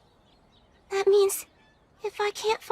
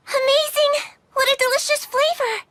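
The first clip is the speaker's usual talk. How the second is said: higher, louder delighted exclamation; 7 semitones higher, 3 dB louder than usual. Acted. Girl tastes the child's potato mochi, higher and delighted. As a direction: gush over the flavor.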